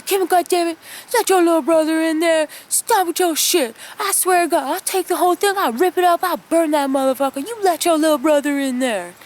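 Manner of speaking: a dad losing it over the pool; angry dad. Retold or imitated. Dad yelling at Kim from the back door, mock furious.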